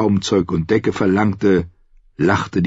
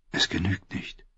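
whispered; 12 dB quieter than usual. Acted. Captain's quiet verdict.

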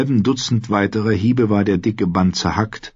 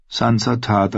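usual speaking voice

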